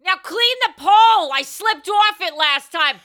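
loud, annoyed Boston stripper; irritated Boston shout; angry Boston stripper. Acted. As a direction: yell at the crew in a Boston accent. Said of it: "Boston accent".

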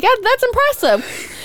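high sing-song yeah; high mock praise. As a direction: squeak it out admiringly. Mocking friends' praise.

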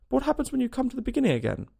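high, quick, venturing question; tentative venture. A narrator's reading. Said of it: "Alice ventured to ask".

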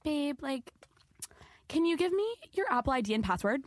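put-on [name]'s Valley Girl voice. Roommate asking a guy for his password, cutesy Valley Girl. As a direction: imitate a roommate sweetly wheedling, Valley Girl style. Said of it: "her little Valley Girl voice".

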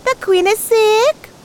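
high, urgent little tortoise; a high, eager tortoise pleading for help. A narrator's reading. Tortoise figure voice.

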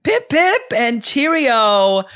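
high, hammed-up British farewell; mock-British cheer, drawn out at the end; playful British send-off. Host teasing guest about London, in hammed-up high cheer.

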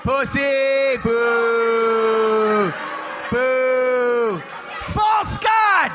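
high shouted jeers with laughter; mock booing. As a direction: jeer him like a rowdy crowd. Booing the quitter.

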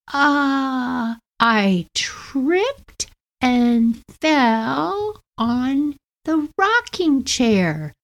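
slow, high, sheepish excuse; sheepish little girl. Retold or imitated. Guilty four-year-old slowly explains to her sister. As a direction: drag out a guilty kid's excuse.

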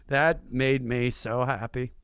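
ordinary talk, nothing marked

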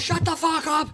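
high-pitched mock yell; imitated angry retort. Player telling off a heckling fan, angry retold yell.

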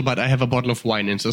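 ordinary talk, nothing marked

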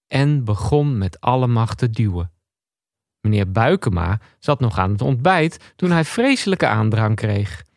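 ordinary talk, nothing marked

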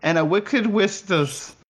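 high, silly mixed-up nursery rhyme voice; mom's word-swap act. Retold or imitated. Friend asks him to do the mom's silly word-swap act, high and goofy. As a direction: garble the fairy tale like a goofy stage act. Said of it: "can you do the mom doing her consonant replacement thing".

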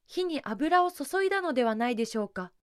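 usual speaking voice

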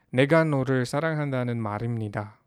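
quick demo read of the Korean line; fast example sentence. Shown as a demonstration. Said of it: "So, example".

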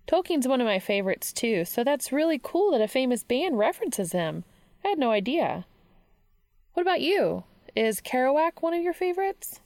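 eager bookworm with lifted, swooping pitch; enthusiastic Charlie. A narrator's reading. Voices Charlie in dialogue.

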